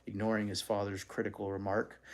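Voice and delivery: ordinary delivery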